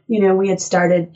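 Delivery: ordinary talk, nothing marked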